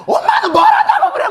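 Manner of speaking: piercingly high, loud mock scream; sudden teenage yell. Middle-schooler snaps back at nagging mother, screaming imitation. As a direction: explode at mother in an exaggerated shriek. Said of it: "갑자기 막 소리를 질러요".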